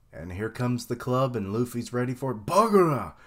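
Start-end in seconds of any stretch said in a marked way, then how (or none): 2.5-3.1 big loud anime attack yell, higher; shouted attack name. Acted. Reacting to the fight, shouting the attack. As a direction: belt out the move name like the show.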